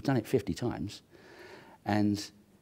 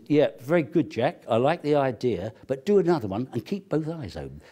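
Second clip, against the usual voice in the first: louder, higher director voice praising; loud impression of director; 5 semitones higher, 6 dB louder than usual. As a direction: boom out the praise as Stanley. Voicing Stanley praising Jack.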